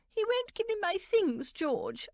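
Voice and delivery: high, distressed complaint; fretful aunt in distress. A narrator's reading. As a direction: appeal in a very high, upset voice. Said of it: "Aunt Dotty said distressfully".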